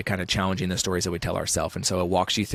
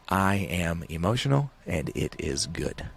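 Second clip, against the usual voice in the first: slow, measured affirmation; deliberate declaration; 3 dB quieter, slower than usual. Shown as a demonstration. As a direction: state it slowly, as a settled conviction. Slowly declaring his conviction.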